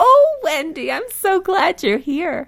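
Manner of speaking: squeaky, very high-pitched greeting; high little squeak full of gladness; Ruth Gosting. Retold or imitated. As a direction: imitate a tiny squeaky voice, delighted to welcome someone. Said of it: "she had the squeakiest little high-pitched voice".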